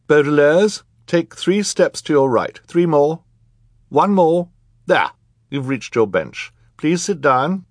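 high, loud, measured instructions; judge, directing. A narrator's reading. Judge guiding children to their bench, directing.

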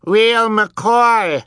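put-on very high, loud toddler squeal; baby's yell. A narrator's reading. Baby Sunny vouching for the truth, high-pitched yell. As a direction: yell it in a tiny piercing voice. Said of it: "Sunny yelled".

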